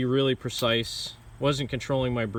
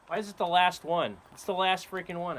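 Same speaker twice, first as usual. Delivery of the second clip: higher pitched mock exasperation; exasperated; 6 semitones higher than usual. Real, not acted. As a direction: complain about the luck, higher. Frustrated it was last shot.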